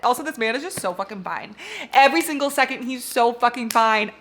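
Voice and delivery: ordinary delivery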